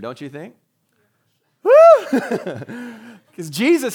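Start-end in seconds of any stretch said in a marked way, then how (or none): 1.6-3.3 high loud mock scream with a laugh. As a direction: scream like a startled onlooker, hammed-up. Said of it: "I'm sure somebody screamed".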